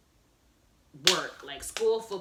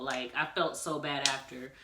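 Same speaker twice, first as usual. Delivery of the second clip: ordinary delivery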